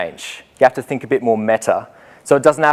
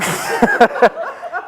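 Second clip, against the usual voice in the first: laugh; 11 semitones higher than usual. Real, not acted. Laughs at own story.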